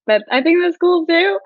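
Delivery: high, loud giggly aside; laughing at her own accent. Real, not acted. Laughing aside after accent demo.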